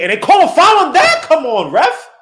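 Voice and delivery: high, loud outraged protest; indignant outcry. Real, not acted. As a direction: yell at the call in disbelief. Complaining about the foul call.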